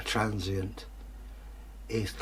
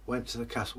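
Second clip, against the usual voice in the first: ordinary delivery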